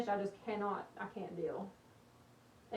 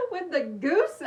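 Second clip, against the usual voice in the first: laughing; 5 semitones higher, 8 dB louder than usual. Real, not acted. Laughing at viewer comment.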